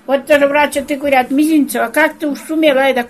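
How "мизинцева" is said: ordinary talk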